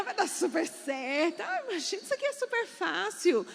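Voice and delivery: high, put-on breezy encouragement; cheering her on. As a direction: play it up as super easy. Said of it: "eu fiquei animando ela".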